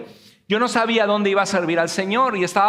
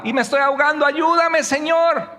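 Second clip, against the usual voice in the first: mock drowning plea; 7 semitones higher than usual. Voicing someone drowning, pleading.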